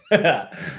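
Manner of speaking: short amused laugh. Real, not acted. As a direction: let a laugh slip out. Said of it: "laughs after demo".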